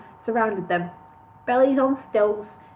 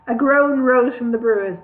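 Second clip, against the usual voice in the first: ordinary delivery, nothing marked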